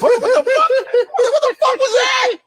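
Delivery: very high, squealing disbelief; laughing, incredulous outburst at a fancy accent; mock disbelief.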